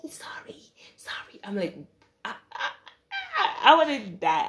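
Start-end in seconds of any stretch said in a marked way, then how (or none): 0.1-1.4 whispered sorry, sorry; whispered apology. Retold or imitated. Friends sneaking off, whispering their apology. 2.2-4.5 laughing despair. Retold or imitated. Abandoned by friends, mock despair through laughter.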